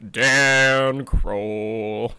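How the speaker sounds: low laughing foghorn retake; booming foghorn name through a laugh. Real, not acted. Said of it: "Why do I sound like a foghorn?".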